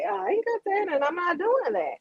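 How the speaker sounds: squeaky imitation of her own comeback; high mock retort.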